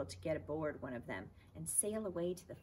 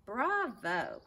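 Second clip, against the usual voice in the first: higher, louder cry of bravo; 7 semitones higher, 8 dB louder than usual. Acted. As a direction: cheer him on loudly. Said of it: "cried the man".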